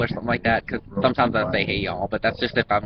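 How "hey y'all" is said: soft, drawled Southern "Hey y'all"; exaggerated Southern accent. Shown as a demonstration. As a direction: lay the Southern accent on thick. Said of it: "over-exaggerating my southern accent".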